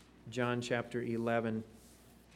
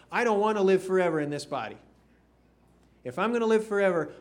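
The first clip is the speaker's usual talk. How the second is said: louder, higher, rushed aside; excited, quick; 5 semitones higher, 8 dB louder, much faster than usual. Acted. Preacher's personal aside to congregation, fast and fired up. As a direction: blurt it out with zeal.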